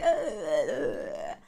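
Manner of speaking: low creaky vocal fry grunt; vocal fry groan. Real, not acted. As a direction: let the voice crackle into fry. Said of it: "vocal fry mess".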